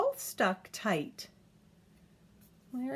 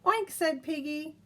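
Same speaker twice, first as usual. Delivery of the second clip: lively picture-book reading; reading to a child; 5 dB louder, slower than usual. A narrator's reading. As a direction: read it aloud to a toddler, bouncy and clear. Reads picture book aloud.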